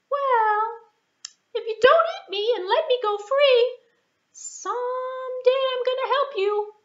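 very high, earnest mouse voice; a tiny mouse making a promise; determined mouse. Acted. Mouse promising to help the lion one day, high and earnest.

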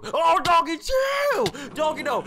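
very high, loud frantic yelling; panicked screaming. Real, not acted. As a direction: scream at an attacking dog to back off. Attacked by the dog, flees.

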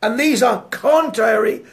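slow, measured Bible reading; scripture reading. Real, not acted. Preacher reading Galatians five to the congregation, measured. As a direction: read the verse with weight.

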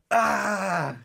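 loud, high mock scream of pain; retold pain scream. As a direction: cry out "Ah!" as if just injured. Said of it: "He starts screaming".

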